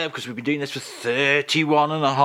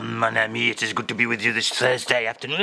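mock-French accent, lower measured voice; 3 semitones lower than usual. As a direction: put on a suave Frenchman greeting his friend. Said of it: "[name] is here".

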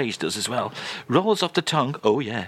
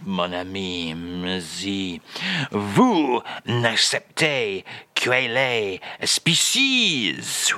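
[name]; 5 semitones lower, much slower than usual. Acted. French guest obliges host, mock [name]. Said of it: "Charles, if you will be so kind".

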